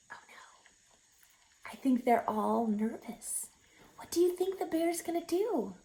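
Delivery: hushed suspenseful whisper. Real, not acted. Teacher whispering to young listeners before the bear wakes, hushed.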